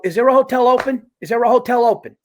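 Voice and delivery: high, loud retold begging; worked-up mock desperation; desperate plea. Retold desperate plea at hotel desk.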